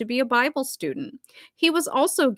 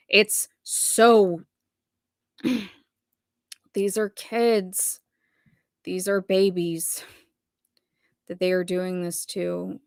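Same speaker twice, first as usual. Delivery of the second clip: holding back emotion; 2 semitones lower, slower than usual. Real, not acted. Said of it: "I'm trying not to get emotional".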